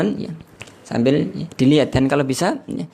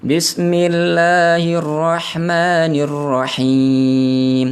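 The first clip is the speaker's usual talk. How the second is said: slow, drawn-out, higher and louder tilawah; clear Quran recitation; 4 semitones higher, 4 dB louder, much slower than usual. Shown as a demonstration. Teacher demonstrating clear recitation of the basmala to students, slow chanted. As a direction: chant each letter clearly and slowly. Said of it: "Dibaca jelas ya".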